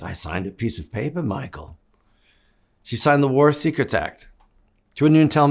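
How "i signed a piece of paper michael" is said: a hint of mom, softer and lower; quietly imitating his mother; hushed mom. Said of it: "She goes".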